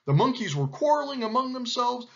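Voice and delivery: ordinary delivery, nothing marked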